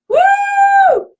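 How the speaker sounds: very high, loud whooping woo; real thrilled yell; excited shout. Real, not acted. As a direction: shout out the new arrival. Cheering a man joining.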